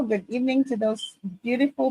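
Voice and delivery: usual speaking voice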